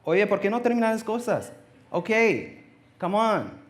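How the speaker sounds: Bolivian mom's voice. Retold or imitated. God in mom's voice nagging George to finish things, high scolding. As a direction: nag like a Bolivian mom, pitch up high. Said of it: "If God had my mom's voice".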